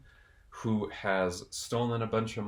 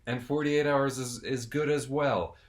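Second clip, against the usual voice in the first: ordinary delivery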